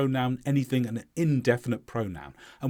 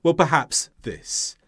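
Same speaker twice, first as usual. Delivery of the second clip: normal talking voice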